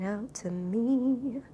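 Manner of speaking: very slow, stretched while scrolling; drawn out. Real, not acted. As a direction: let the words stretch out absent-mindedly. Drawling while browsing list.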